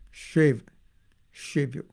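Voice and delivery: "save" said with a Bengali sh, "shave"; sh at the start of "shave". Shown as a demonstration. Teacher repeating the Bengali slip, "shave" for "save". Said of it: "he pronounced it as shave".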